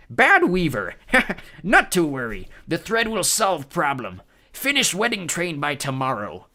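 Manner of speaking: loud high cyclops, slow; grinning cyclops. A narrator's reading. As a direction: gloat like a pleased giant. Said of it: "Polyphemus grinned".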